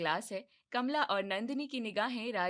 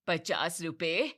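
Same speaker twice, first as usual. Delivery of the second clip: slow, louder disbelieving पचास रुपए rising at the end; shocked repeat; 4 semitones lower, 3 dB louder, slower than usual. Acted. Mother-in-law scoffing at fifty rupees, slow disbelief.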